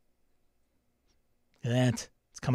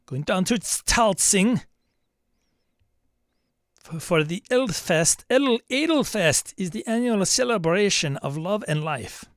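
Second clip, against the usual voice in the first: hammed-up German accent, pitched higher; mock German accent; 6 semitones higher than usual. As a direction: put on a playful German accent for the village names. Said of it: "[name], don't do accents".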